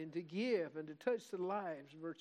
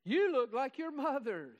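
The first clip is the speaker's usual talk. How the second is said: excited exclaim; 9 semitones higher, 10 dB louder than usual. Retold or imitated. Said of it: "You know what I said?".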